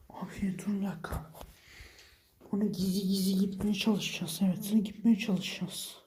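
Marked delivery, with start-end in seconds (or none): 0.1-1.3 hushed, stopping short; whisper, freezing in place; tense whisper. Real, not acted. Sneaking up on the monster.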